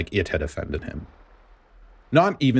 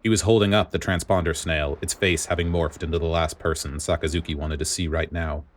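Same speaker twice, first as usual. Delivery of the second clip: ordinary delivery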